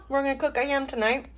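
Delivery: talking while chewing; mouth full. Real, not acted. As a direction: say it round a mouthful of food. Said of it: "try not to talk with my mouth full".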